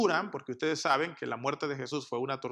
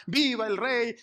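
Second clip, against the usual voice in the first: higher, mock crowd cheer; 6 semitones higher, 3 dB louder, slower than usual. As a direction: cheer the new king, half acted. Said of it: "empezaron a gritar".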